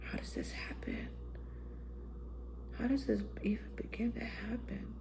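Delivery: hushed inner voice, breathless; stunned whispered thought; awed whisper. Retold or imitated. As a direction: whisper it like a thought you can't believe. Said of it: "In my head, I was like".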